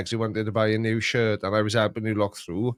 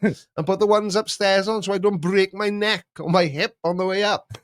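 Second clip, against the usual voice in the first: higher-pitched playful scolding; mock exasperated retort; 8 semitones higher than usual. Owner telling Google to just switch lights, mock exasperation. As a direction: tell off a cheeky gadget. Acted scolding of a cheeky device.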